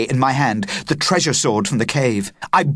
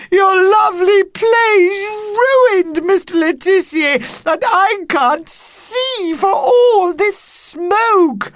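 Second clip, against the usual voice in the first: whiny crying; 5 dB louder, slower than usual. Acted. Upset child complaining, crying.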